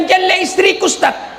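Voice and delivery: high whiny complaint with a touch of laughter; mock whining husband. Husband complaining to the ustadz about his ugly wife, high mock whine.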